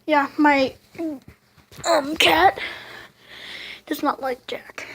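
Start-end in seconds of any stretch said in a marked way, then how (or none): 3.9-4.8 whispered aside; unvoiced confiding aside. Real, not acted. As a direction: whisper it like a secret.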